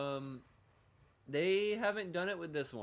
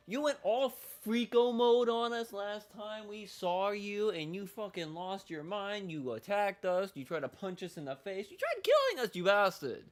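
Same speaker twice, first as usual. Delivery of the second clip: high, playful-angry tirade; indignant rant; 6 semitones higher, 5 dB louder than usual. Pretend. Player accusing game character of attacking them, indignant. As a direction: scold him with rising outrage.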